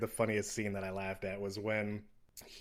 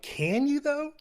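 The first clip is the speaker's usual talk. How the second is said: incredulous; 7 dB louder, slower than usual. Retold or imitated. Mocking the mom's line.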